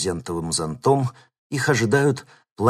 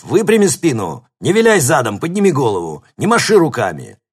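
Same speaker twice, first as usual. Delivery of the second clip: loud barking coach commands; barking gym coach; 6 semitones higher, 9 dB louder than usual. A narrator's reading. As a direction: bark orders at the runners. Coach yelling at runners.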